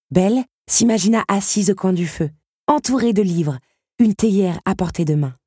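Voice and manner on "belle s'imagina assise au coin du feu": ordinary delivery, nothing marked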